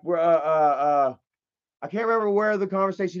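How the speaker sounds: ordinary talk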